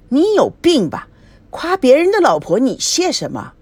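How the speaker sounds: annoyed scolding. A narrator's reading. Wife scolds husband for thanking a compliment, high indignant scolding. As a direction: snap at him for being silly.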